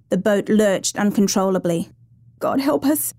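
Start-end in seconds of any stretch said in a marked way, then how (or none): 2.4-3.1 high, understated plea; praying. A narrator's reading. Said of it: "Sylvia prayed".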